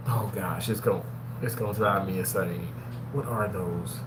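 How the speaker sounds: low, soft muttering to himself; frustrated mumble. Real, not acted. Host straining to recall a food name, muttering.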